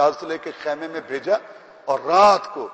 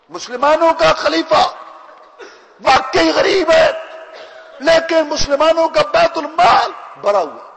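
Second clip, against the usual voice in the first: ringing proclamation; 12 semitones higher, 7 dB louder than usual. Acted. Caliph tells the widow the treasury is full, in a high loud shout. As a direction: proclaim it to the whole ummah.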